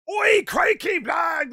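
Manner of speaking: high, shouted cartoon Australian; wildly exaggerated Australian holler; over-the-top Australian accent. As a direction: yell like a hammed-up Aussie caricature. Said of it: "it was so over the top".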